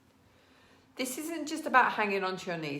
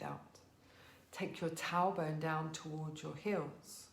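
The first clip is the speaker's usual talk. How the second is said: soft, calm yoga instruction; soothing teacher's voice, getting softer; guided yoga cue; 5 dB quieter than usual. Acted.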